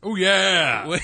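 drawn-out mascot holler; Kool-Aid Man. Acted. Podcaster hams it up for laughing co-hosts, Kool-Aid Man voice. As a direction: crash in with an over-the-top cheer. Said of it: "twenty percent Kool-Aid, man".

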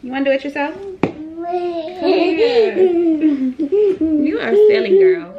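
high, loud sing-song play. Real, not acted. Playful coaxing of toddler.

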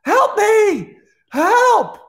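very high, loud desperate scream; screaming for help. Acted. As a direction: yell for help at full force. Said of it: "someone screaming".

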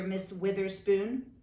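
ordinary delivery

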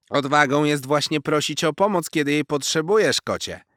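high, loud wise little squirrel warning; a cheeky squirrel teasing a show-off kitten; squirrel Zosia. A narrator's reading. Squirrel warning the boastful cat, high and loud.

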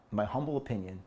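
ordinary talk, nothing marked

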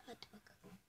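barely voiced whisper. Real, not acted. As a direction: breathe it out, almost silent. Whispered before shot.

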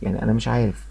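ordinary talk

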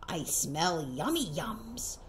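a hint of a snuffly badger sniffing out food. A narrator's reading. Badger smells the food, speaks low and soft. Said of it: "A badger scuttles by, sniff snuffs at the air".

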